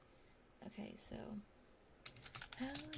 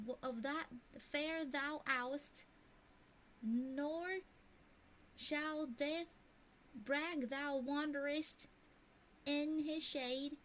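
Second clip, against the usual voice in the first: higher-pitched, put-on mock Texan accent reading Shakespeare; made-up Texan-ish accent; 6 semitones higher than usual. Streamer reads a Shakespeare sonnet to chat in a made-up Texan-ish accent. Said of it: "this sounds Texan".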